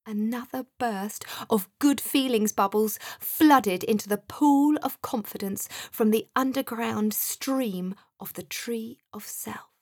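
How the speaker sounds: usual speaking voice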